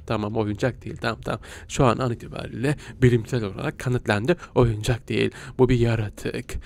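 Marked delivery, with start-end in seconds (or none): 0.1-1.4 low, hushed murmur. Real, not acted. Gamer hushing as the toy turns out to be a creature. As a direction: whisper it like you've just realized it's alive.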